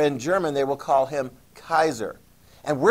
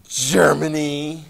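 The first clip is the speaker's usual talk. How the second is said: low vengeful French threat; 3 semitones lower than usual. Retold or imitated. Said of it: "they're like".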